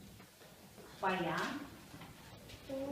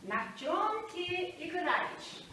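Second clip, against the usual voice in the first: slow and deliberate, every word clear; slow, careful teacher demo; 5 semitones higher, slower than usual. Shown as a demonstration. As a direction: model the phrase slowly for pupils. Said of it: "сегодня мы изучаем на тема".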